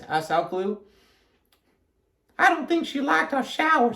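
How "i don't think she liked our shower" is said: high-pitched character voice; Amelia Bedelia. A narrator's reading. Amelia Bedelia to Alkaloo, high character voice. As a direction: say it up high at normal volume. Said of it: "said Amelia Bedelia".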